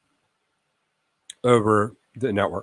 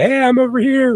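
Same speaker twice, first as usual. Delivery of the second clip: much higher, louder call across the room; 5 dB louder than usual. Acted. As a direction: shout out to be found. Device announcing itself on network.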